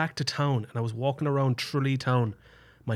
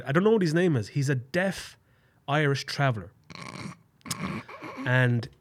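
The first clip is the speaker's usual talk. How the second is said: normal talking voice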